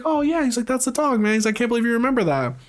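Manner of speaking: a flash of hope from the son; hopeful son. Retold or imitated. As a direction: son thrilled his mother remembers the dog, high and quick. Son excited she remembers.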